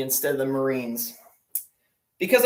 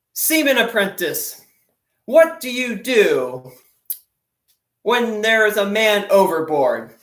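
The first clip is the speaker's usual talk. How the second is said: higher and louder, slow, weighty question; stern admiral; 5 semitones higher, 5 dB louder than usual. Retold or imitated. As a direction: drag it out to build suspense. Admiral poses the test question.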